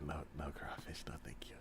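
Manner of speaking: soft whispered no thank you; whispered refusal. Real, not acted. Friend turning down crawfish in a whisper. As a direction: whisper it under your breath.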